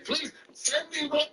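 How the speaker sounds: high-pitched, repeated demand; insistent challenge. Real, not acted. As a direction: press the point, pitch jumping up. Repeatedly demands proof from opponent.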